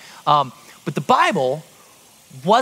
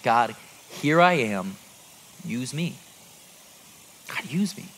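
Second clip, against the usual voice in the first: quiet prayer; 7 semitones lower, 4 dB quieter than usual. Retold or imitated. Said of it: "I remember praying".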